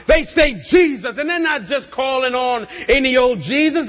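ordinary talk

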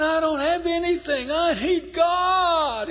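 rising to a high, loud, drawn-out cry; desperate cry. Retold or imitated. Preacher voicing the drunk man's cry for God. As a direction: plead for God with everything.

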